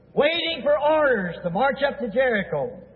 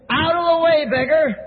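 high, loud, rough command; 5 semitones higher, 3 dB louder than usual. Acted. Priest to blind beggar blocking the road, rough voice. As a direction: order him roughly aside, pitched up. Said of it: "we hear a real rough voice coming".

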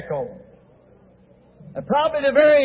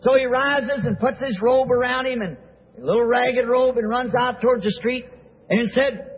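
usual speaking voice

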